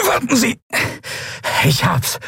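high strained reptilian voice; lizard creature voice. Acted. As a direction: gasp it out as the reptile at the lock. Draxyl works the stuck lock. (hohe gepresste Echsenstimme)

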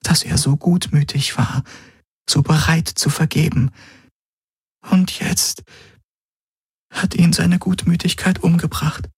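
a lump in the throat, voice cracking; choked with grief. A narrator's reading. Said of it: "den Kloß in ihrer Kehle".

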